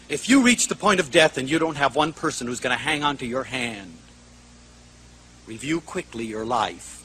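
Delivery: low, soft, solemn reflection; hushed, solemn. Real, not acted. Speaker urging audience to picture death, hushed and solemn. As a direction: let it sink to a grave hush.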